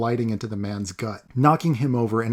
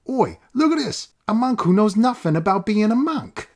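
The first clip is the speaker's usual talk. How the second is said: high, coarse Cockney taunt; loud mocking street ruffian voice; rough street thug; 11 semitones higher, 5 dB louder than usual. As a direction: sneer at the boy like a street tough. Stranger jeering to the crowd.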